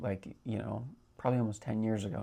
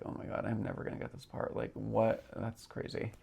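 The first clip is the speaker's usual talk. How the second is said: whispered, fast self-doubt; defeated whisper; 2 semitones lower, 7 dB quieter than usual. Retold or imitated. Doubting herself about the part, in a quick whisper. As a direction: mutter it under your breath, beaten. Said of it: "saying to myself".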